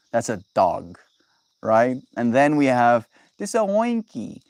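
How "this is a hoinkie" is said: put-on higher, cutesy baby talk; cute diminutive voice. Shown as a demonstration. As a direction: say it like something tiny and cute. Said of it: "Then you say hoinkie".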